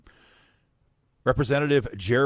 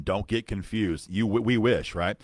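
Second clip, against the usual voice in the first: ordinary talk, nothing marked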